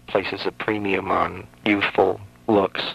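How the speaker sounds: ordinary talk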